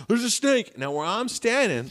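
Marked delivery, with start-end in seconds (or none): 0.1-0.8 mild alarm. Retold or imitated. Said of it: "he goes".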